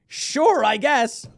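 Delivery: high-pitched, amused shrug; wry concession. Real, not acted. Wry reaction after laughing.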